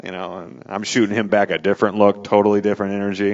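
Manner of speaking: normal talking voice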